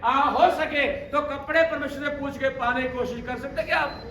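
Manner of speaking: high, loud, rousing sermon cry; fiery preaching. Acted. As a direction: whip the congregation up, shouting to the back.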